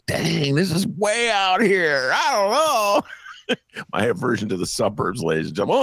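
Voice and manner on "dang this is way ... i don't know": put-on young [name] amazed. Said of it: "It was like".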